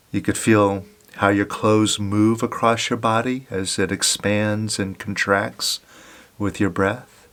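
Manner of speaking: guided meditation. Acted. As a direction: gently guide them to watch the breath. Leads a breath meditation.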